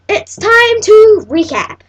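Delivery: loud, high, drawn-out announcement; big announcer call. Pretend. Tutorial host launching the recap, announcer-style. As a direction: belt it out slowly like a show host.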